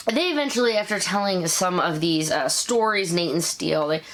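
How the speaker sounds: usual speaking voice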